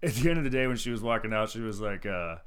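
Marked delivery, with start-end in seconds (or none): none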